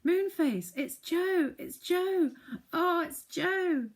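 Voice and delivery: very high and loud joyful cry; excited yell. Acted. Sisters recognising their brother, high loud yell. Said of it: "yelled out loudly".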